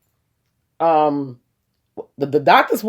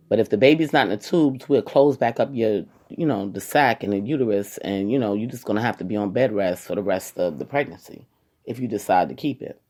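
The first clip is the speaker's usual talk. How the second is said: ordinary talk, nothing marked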